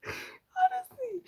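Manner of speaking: high, slow laugh-cry. Real, not acted. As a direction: squeal it through laughter. Laughing-crying after laugh.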